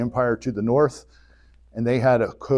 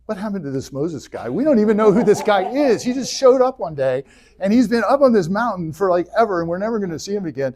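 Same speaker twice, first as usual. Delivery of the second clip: grumbling people getting worked up; complaining crowd; 8 semitones higher than usual. Retold or imitated. The people complain to Aaron, building whine. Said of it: "they're like".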